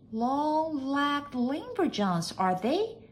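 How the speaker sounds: high, drawn-out maid's echo; very slow, high questioning maid; wondering maid. A narrator's reading. Maid echoes odd name.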